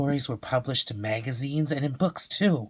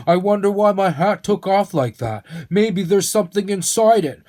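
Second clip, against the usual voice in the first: put-on higher, louder musing old man; wondering old man; 8 semitones higher, 7 dB louder than usual. Mister Zinger wonders to Leo about his hat. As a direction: puzzle over the hat aloud.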